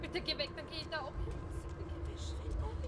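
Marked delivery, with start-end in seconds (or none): 1.8-2.9 slow, higher whispered plea; frightened whisper. Real, not acted. Player begging the creature to go away, slow whisper. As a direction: breathe it out, scared to be heard.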